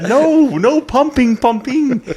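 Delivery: high, loud, drawn-out mock yell; yelling director. Director scolds singer for arm pumping, hammed-up high yell. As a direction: holler it slowly like an exasperated director. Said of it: "I was getting yelled at again".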